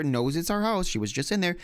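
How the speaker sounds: ordinary talk, nothing marked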